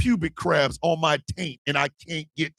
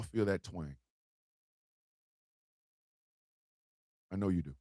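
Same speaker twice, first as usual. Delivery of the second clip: quiet, lower, comforting tone; soft reassurance; 9 semitones lower, 7 dB quieter than usual. Real, not acted. Comforting viewers who feel the twang, quiet and low. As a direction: console them gently.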